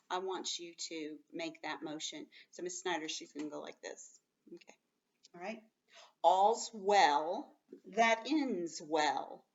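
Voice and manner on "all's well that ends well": slow, deliberate proverb read; teacher reads the proverb slowly to the class; slow saying. Shown as a demonstration. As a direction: lay out each word slowly. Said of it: "So in this case".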